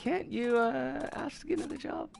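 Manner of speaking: put-on high childish voice. Daughter asking a parent about jobs, high and small. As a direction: ask like a small hopeful kid.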